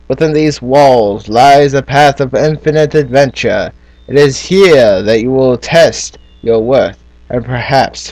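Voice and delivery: put-on storybook narration in a British accent. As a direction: read it like a British fantasy narrator. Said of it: "talking in a British accent".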